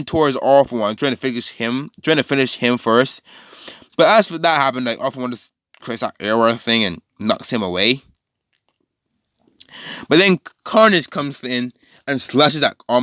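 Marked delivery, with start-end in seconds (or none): none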